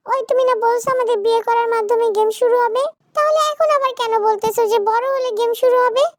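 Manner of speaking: high-pitched insistent complaint; argumentative child wife. Acted. Zara accusing her husband of changing his story, high and insistent. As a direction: challenge him in a high girlish voice.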